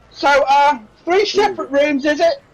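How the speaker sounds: put-on high-pitched, harried serving girl; flustered barmaid. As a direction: take the order while put out. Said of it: "She comes over all in a tears".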